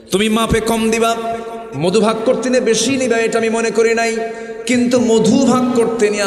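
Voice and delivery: high, loud preaching cry; preaching at full force. Acted. Preacher crying out to the congregation in a loud sermon. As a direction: rise up and preach it hard.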